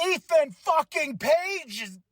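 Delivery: high, slow stunned gasp; shocked. Real, not acted. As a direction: gasp in disbelief.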